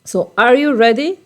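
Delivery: high, loud questioning call; lively acted question. Shown as a demonstration. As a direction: ask it brightly, like a real question. Teacher acts out a question.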